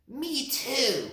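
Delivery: a small rabbit eagerly joining in; little rabbit. A narrator's reading. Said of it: "said the little rabbit".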